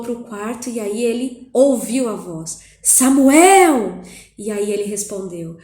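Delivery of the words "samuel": put-on a loud, drawn-out call of the boy's name; the voice of God calling in the night. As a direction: call Samuel from far away, loud and long. The voice calls once more.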